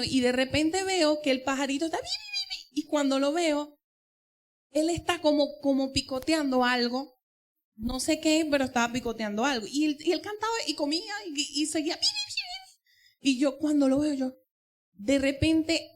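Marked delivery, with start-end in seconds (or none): 2.0-2.8 high, thin chirping like a little bird; tiny high bird chirps; birdsong chirp. Retold or imitated. Imitating the bird's song. 12.0-13.3 high, thin chirping like a little bird; tiny high bird chirps; birdsong chirp. Retold or imitated. Imitating the bird again.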